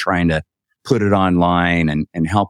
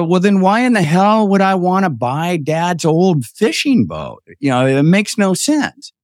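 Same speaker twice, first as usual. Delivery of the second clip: high-pitched exasperated protest; retold exasperation; 9 semitones higher than usual. Man telling his brother the boat makes no sense, exasperated. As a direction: argue back, pitched up.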